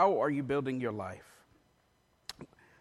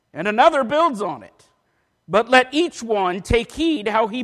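loud, raised, measured pulpit reading; emphatic scripture reading; 3 semitones higher, 7 dB louder than usual. Acted. Preacher reading Paul's letter to church, loud emphatic proclamation. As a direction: proclaim the verses, punching key words.

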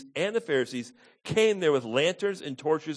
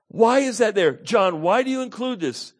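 high, loud rhetorical questioning; emphatic preaching; 8 semitones higher, 7 dB louder than usual. Acted. Preacher asking John why he wrote this, emphatic preaching. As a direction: throw the question out forcefully.

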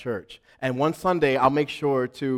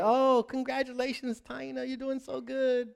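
high, soft, sugary insincere praise; mock-sweet praise for a rival business owner; fake congratulations; 12 semitones higher, 4 dB quieter than usual. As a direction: sweeten it while seething. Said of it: "and we say".

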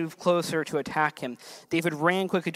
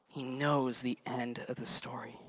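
soft slow repeat; 5 semitones lower, 10 dB quieter than usual. Acted. Preacher repeats key line softer.

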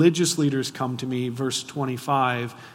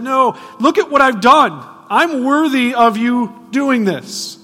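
loud, high and drawn-out pleading; preacher voicing the woman arguing she's worthy, loud and high; pleading self-justification; 9 semitones higher, 10 dB louder, slower than usual. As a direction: beg loudly, listing your merits. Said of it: "She doesn't convince Jesus that".

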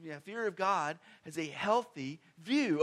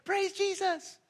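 high, bright mock "Praise Jesus" in a much higher voice; mock cheery praise; 14 semitones higher, 3 dB louder, slower than usual. Preacher mocking a shallow worship reaction, high mock cheer. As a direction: lampoon the happy-clappy worshipper, pitched way up. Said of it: "He doesn't go".

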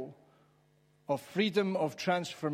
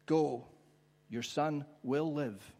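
slow, measured promise, pause after Go; calmly assure, giving each word room; gentle assurance; slower than usual. Shown as a demonstration. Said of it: "make them say all sorts of different things".

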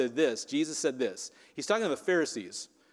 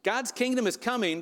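high, proclaiming "God's kingdom is coming"; Jesus proclaiming to the Pharisees, raised high; as Jesus might have said; 8 semitones higher than usual. Retold or imitated. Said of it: "how I think he might have said this".

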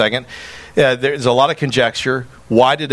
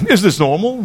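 higher-pitched, questioning retold voice; puzzled question; 7 semitones higher than usual. Friend asking about the stranger on the lake, puzzled.

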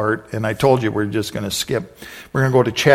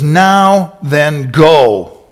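very slow, louder command; 2 semitones higher, 7 dB louder, much slower than usual. Acted. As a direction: drag it out with authority. God commanding slowly.

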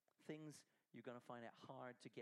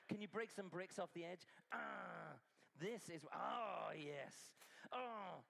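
excited cheering; 5 semitones higher, 5 dB louder, slower than usual. Acted. Preacher cheering the smashing.

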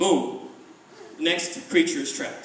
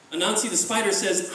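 ordinary delivery, nothing marked

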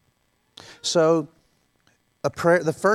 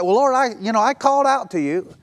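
self-crediting plea; 7 semitones higher, 5 dB louder than usual. Retold or imitated. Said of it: "some people seem to imply".

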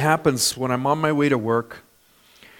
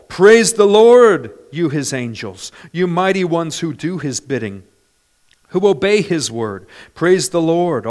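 loud praise lines, easing between them; psalm proclamation; 5 dB louder than usual. Acted. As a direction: proclaim the psalm with ringing emphasis on each praise.

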